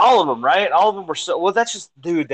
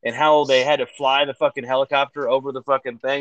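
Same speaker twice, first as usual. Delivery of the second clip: usual speaking voice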